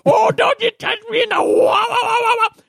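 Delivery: high, shrieking squeals of a scuffle; high shouted squabble on the floor; squabbling old men. Acted. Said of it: "and they're going".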